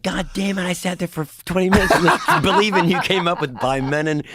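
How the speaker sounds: high, loud retold outburst; mock outraged rant. Guest imitates the duped host losing it, high mock rant. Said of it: "he lost his shit. He's like".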